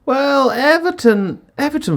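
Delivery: high, slow drawn-out Everton; announcer intro. Acted. Host opening the Everton segment, drawn out. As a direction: stretch out the team name like a show host.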